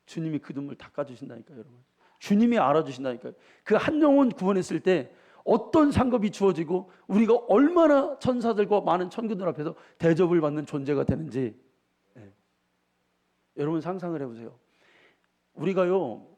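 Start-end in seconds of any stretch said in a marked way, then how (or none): 2.2-11.6 loud, higher preacher's push; fervent sermon delivery; full-force preaching. Acted. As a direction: press the promise on the congregation.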